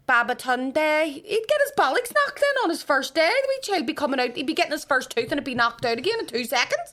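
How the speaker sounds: high, loud, incredulous rant; outraged protest. Retold or imitated. Mother arguing against a baby name in West Belfast, outraged. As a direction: argue it at the top of your range.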